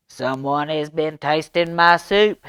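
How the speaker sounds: roar. Acted. Papa Bear finding his spilled soup, roaring loudly. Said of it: "he roared".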